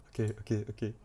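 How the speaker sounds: normal talking voice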